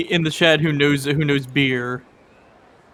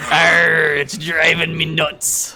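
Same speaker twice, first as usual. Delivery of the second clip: pirate voice; much slower than usual. Acted. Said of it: "And the pirate goes".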